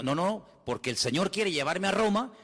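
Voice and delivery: ordinary delivery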